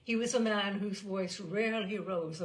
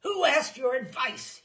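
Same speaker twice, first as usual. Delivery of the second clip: loud, furious bluster; 6 semitones higher, 10 dB louder than usual. A narrator's reading. General blusters at the narrator, furious and loud. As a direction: bark it out in a rage. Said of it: "he blustered".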